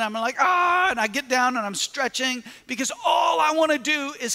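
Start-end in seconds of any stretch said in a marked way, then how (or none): 0.4-0.9 sharp high yelp of pain, much louder; retold pain scream. Runner yelling as his calf cramps, a high pained cry. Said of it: "I'm screaming".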